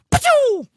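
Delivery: high burst of pachoo; firework whoosh. A narrator's reading. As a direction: spit out the firework noise. Firework sound effect.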